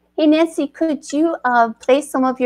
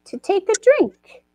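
lively storybook narration; story narration. A narrator's reading. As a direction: tell it like a picture book read aloud.

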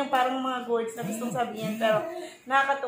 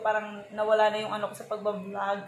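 usual speaking voice

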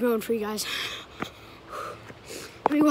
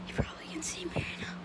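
whispering; 5 semitones higher, 9 dB quieter, faster than usual. Real, not acted. Hiding from a homeowner.

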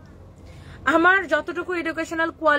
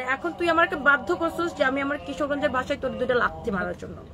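ordinary talk, nothing marked

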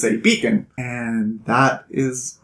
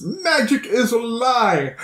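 higher, drawn-out mock warning; retold teacher's warning; 8 semitones higher, slower than usual. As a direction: stretch it out high like a lightly acted warning.

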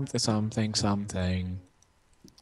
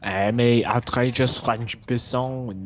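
hammed-up French accent, slow; cartoon French flourish; outrageous French accent; slower than usual. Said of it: "my outrageous French person accent".

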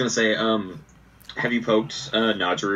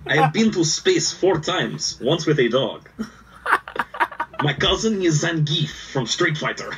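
slow, higher hammed-up Russian accent; 6 semitones higher, slower than usual. Co-host plays a Russian cosmonaut, slow mock Russian. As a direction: drag it out in a mock Russian accent, laughing at the end. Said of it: "a pretty good Russian or Irish accent".

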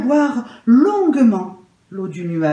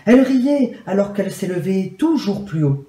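ordinary delivery, nothing marked

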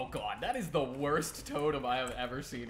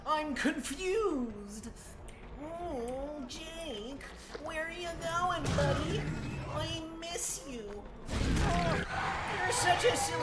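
much higher, sing-song taunting of the survivor; teasing high voice; 4 dB louder, much slower than usual. Pretend. Killer streamer taunting survivor Jake, high sing-song teasing. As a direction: coo at him like a pet you're hunting. Taunting the two Jakes again.

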